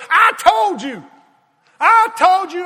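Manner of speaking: loud, high I-told-you shout; nagging wife. Retold or imitated. Wife gloating loudly at her husband. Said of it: "she starts picking at him".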